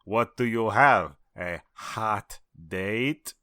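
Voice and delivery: very slow, word-by-word question; slow movie quote. Acted. Quoting it at somebody in a hurry, drawn out slowly. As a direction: drag out every word deliberately. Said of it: "from When Harry Met Sally".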